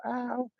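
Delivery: soft, high yelp of ow; girls' ow. Retold or imitated. Mimicking girls in the club, soft high ow. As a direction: squeal a soft ow. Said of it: "they go".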